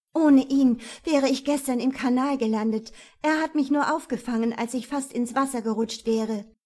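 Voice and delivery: loud, high, indignant retort; angry. Acted. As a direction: fire back in rising anger. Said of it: "Wut stieg in Sabine auf".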